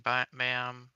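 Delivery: normal talking voice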